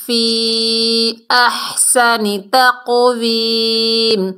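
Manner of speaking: slow, drawn-out Quranic recitation; tajweed recitation. Shown as a demonstration. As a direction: chant each word slowly like in tilawat. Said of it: "Quran recitation demo". (آہستہ تجوید کے ساتھ تلاوت)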